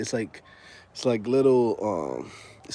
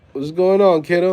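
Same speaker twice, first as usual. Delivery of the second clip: loud, raised greeting to a kiddo; calling out; 5 semitones higher, 8 dB louder than usual. Real, not acted. Greets another kid popping up.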